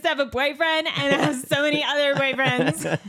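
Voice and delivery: mock complaint. Mock complaint, playful boast.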